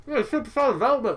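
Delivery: "Superstar Development" mumbled through a mouthful of food; mouth full. Real, not acted. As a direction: say "Superstar Development" while chewing a bite. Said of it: "I just took a bite".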